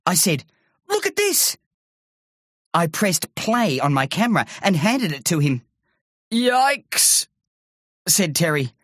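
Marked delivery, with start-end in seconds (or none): 0.9-1.6 put-on boy voice. A narrator's reading. Andy voiced. 6.3-7.3 frightened yikes. A narrator's reading. Terry frightened.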